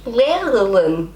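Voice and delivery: slow, careful learner's "Lehrerin"; attempted throaty r. Shown as a demonstration. Learner copying the recording, slow careful attempt. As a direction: stretch "Lehrerin", working at both r sounds. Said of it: "that in your throat".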